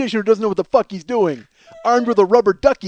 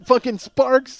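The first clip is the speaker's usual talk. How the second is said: ordinary talk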